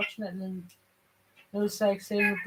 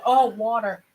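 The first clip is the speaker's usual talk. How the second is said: put-on American-accented "wah-der", louder, as a realisation; 8 dB louder than usual. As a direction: imitate an American cashier finally getting it. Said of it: "the American cashier person couldn't understand".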